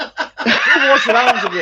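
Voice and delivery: slow, high, laughing through it. Real, not acted. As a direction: repeat it slowly while cracking up. Repeats the line, laughing.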